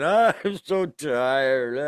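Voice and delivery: slow, weary New York drawl; tired New York accent, stretched out. Retold or imitated. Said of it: "in her New York accent".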